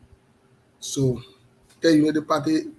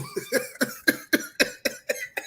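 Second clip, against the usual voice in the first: high laugh; 4 dB quieter than usual. Real, not acted. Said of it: "But you left grandma too".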